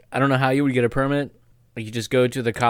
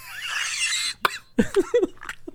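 high-pitched wheezing laugh; long, helpless high laugh; laughter; 4 dB quieter than usual. Real, not acted. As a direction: break up in a squeal of laughter. Helpless laugh at the sardine law.

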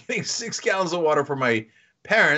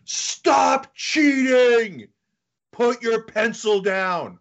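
slow, stretched accusation; retelling a classmate calling him out in the exam hall; retold scream; 5 semitones higher, 4 dB louder, much slower than usual. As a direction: drag out the accusation. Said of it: "somebody screams".

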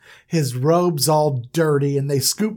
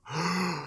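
shocked gasp; 11 dB quieter than usual. Retold or imitated. Brother finds only the coat.

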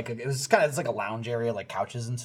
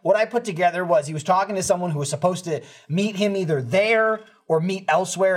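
ordinary talk, nothing marked

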